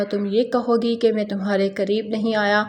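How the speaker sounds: usual speaking voice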